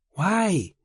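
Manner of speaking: gasping question. A narrator's reading. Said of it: "She gasped".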